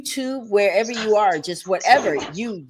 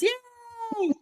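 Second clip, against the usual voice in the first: excited cheer; 5 dB quieter than usual. Real, not acted. Host cheers as guest introduced.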